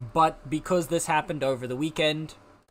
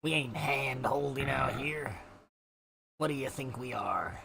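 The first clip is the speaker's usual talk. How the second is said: pirate voice; 6 dB quieter than usual. Acted. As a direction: growl it like an old sea dog. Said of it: "I'm going for a pirate voice with this".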